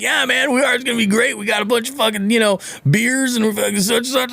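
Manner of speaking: high, goofy dude voice imitating an oblivious boater; carefree party guy. Imitating the boater at the ramp, goofy high dude voice. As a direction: play a clueless excited partier. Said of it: "he's like".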